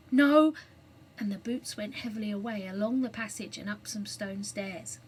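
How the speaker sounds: ordinary delivery, nothing marked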